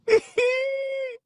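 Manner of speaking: loud squealing cackle; helpless laughing. Real, not acted. Streamer laughing in defiance of critics, loud squealing cackle. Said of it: "I'm laughing".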